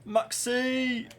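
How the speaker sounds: high excited call. Real, not acted. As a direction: cheer the name.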